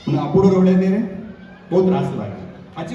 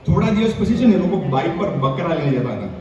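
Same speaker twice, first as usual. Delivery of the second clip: usual speaking voice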